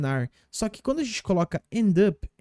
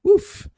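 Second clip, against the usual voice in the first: sharp high relieved "oof"; a near crash survived, a quick "oof"; 8 dB louder than usual. Shown as a demonstration. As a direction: let out a startled oof after a near miss. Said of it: "demonstrating a near crash".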